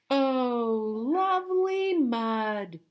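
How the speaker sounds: very high, loud, slow delighted cry; animals' chorus. A narrator's reading. Teacher voicing all the animals together, very high slow delight. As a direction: drag it out in blissful joy. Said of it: "they said".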